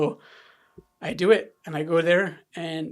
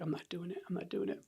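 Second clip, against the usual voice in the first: whispering; 12 dB quieter than usual. Retold or imitated. Retold refusal, whispered.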